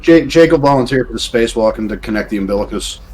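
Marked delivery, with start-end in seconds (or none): none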